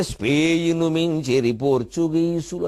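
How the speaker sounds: స్పెయిన్ drawn out as "speeey-inu" in slow verse; slow metrical recital with a lengthened "spee" in స్పెయిన్; long vowel in స్పెయిన్. Shown as a demonstration. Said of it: "అని స్పేకి దీర్ఘం పెట్టాం".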